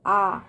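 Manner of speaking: आ held long and low; open-mouth vowel demo. Shown as a demonstration. Teacher shows children the mouth opening, आ long and low. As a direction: open the mouth wide on a slow आ. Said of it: "आ मुंह खुल रहा है ना".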